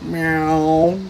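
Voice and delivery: plain little cat-like meow; snail meow. A narrator's reading. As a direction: meow like a tired pet snail. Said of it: "said Gary".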